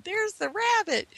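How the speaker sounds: high excited shout from the sisters; little girls squealing at the rabbit; excited scream. Retold or imitated. Sisters spotting the rabbit in the driveway, high excited scream. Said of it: "My two sisters are screaming".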